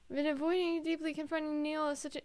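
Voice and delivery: ordinary delivery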